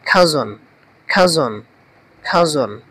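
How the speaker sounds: "খাজন" as kha-zon, each one falling; pronunciation drill. Shown as a demonstration. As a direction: repeat "খাজন" separately, pausing for the class. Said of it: "এটার উচ্চারণটা কি হবে?". ("খাজন" খা-জন, থেমে থেমে উচ্চারণ)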